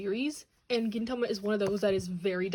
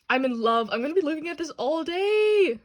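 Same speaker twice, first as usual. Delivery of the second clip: higher and louder, swooning over it; smitten gushing; 5 semitones higher, 6 dB louder than usual. Real, not acted. Collector swooning over her new art book, higher and louder. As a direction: fall in love with the book out loud.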